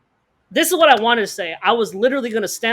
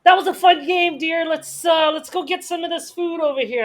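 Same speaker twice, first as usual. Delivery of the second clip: high, sing-out imitation of a cheery fiancée; fiancée's voice; 7 semitones higher than usual. Fiancée leading him off to get food, brightly placating. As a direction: chirp high to steer him away. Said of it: "And then she just comes up".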